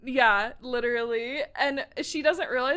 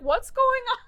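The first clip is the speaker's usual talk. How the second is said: higher-pitched puzzled question; mock bewilderment; 11 semitones higher, 4 dB louder than usual. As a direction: act out someone suddenly lost. Retold bewildered reaction.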